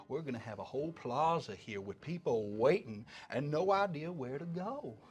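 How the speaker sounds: drawling, self-important elephant folk, folksy Southern voice; folksy elephant showman. Acted. Game master voices elephant folk.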